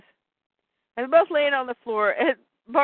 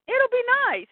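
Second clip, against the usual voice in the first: high chirpy Bart; 13 semitones higher than usual. Acted. Bart's line voiced high.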